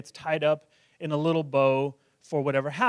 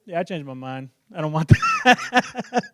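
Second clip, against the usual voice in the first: imitated picky kid. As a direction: turn down the meal like a fussy child. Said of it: "and they're like".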